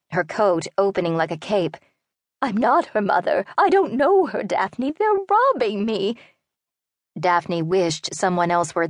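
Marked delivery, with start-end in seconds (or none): 2.4-6.2 put-on an old lady begging not to be robbed; pleading old woman. A narrator's reading. Old woman denies daughter.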